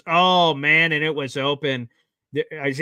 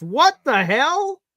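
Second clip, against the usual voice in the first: high, slow, drawn-out shocked cry; stunned disbelief; 10 semitones higher, 4 dB louder, much slower than usual. Real, not acted. Fan watching his team waste the timeout, high incredulous cry. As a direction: let it rise in real shock at the blunder.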